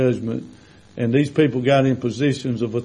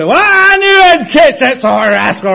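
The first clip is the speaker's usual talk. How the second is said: high, loud sneering gossip voice; mocking judgmental churchgoer; 12 semitones higher, 8 dB louder than usual. Preacher mocking a smug judge, high sneer.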